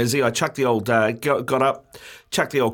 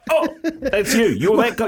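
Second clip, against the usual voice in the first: normal talking voice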